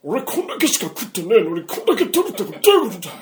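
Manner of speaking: high, loud angry shouting; angry customer. Retold or imitated. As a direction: yell it like a furious customer. 客が怒鳴る場面を演じる. (店主に怒鳴る客、高く大きな怒声)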